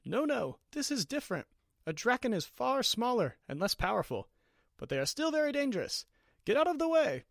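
building panic ending in a shouted order; urgent warning. Acted. Wizard hurrying Ariana out of the venom's path, building to a shout. Wizard warns during the fight.

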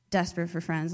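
ordinary delivery

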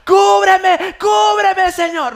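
high, very loud panicked cries; screamed prayer. Acted. Mother praying down the slide, mock panicked screaming. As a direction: shriek for divine protection, hammed up. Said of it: "empiezo a escuchar los gritos".

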